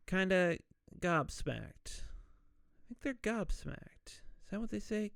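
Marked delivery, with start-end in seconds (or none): 2.0-5.1 soft, slower musing; doubtful aside. Real, not acted. Podcaster second-guessing a word choice, soft and slow. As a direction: wonder it softly to yourself.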